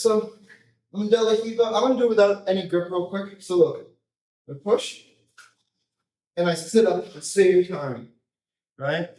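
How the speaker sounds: usual speaking voice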